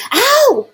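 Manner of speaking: high loud yelp of pain; sharp cry of pain. A narrator's reading. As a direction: yelp at the scalding soup. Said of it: "she cried out".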